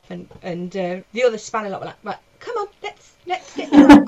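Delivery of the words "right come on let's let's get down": raised, stumbling plea building louder; retold urgent intervention. The other lot pulling the man back, urgent imitation. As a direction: imitate bystanders urgently calling someone off. Other men urging him down.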